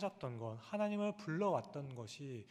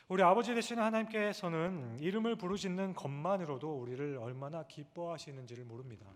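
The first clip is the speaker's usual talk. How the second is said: normal talking voice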